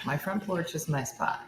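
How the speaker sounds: talking around ice cubes; ice in mouth. Real, not acted. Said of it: "I have ice in my mouth".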